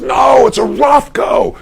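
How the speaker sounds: slow, high, emphatic insistence; insistent correction. Retold or imitated. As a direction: hammer home that it's a Rothko. Friend insists on his painting.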